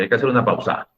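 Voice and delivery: "pausa" said as pau-SA at the end of the phrase; misplaced final stress. Shown as a demonstration. Teacher mocks a pronunciation nobody uses, "pausa" stressed on SA. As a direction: stress the SA of "pausa", as a mock example. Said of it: "Nadie pronuncia pausa".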